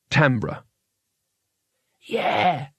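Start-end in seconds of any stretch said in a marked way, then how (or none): 2.0-2.7 put-on slightly raised young wizard's agreement; youngest wizard. A narrator's reading. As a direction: agree like the junior of the gang. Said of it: "said the youngest wizard".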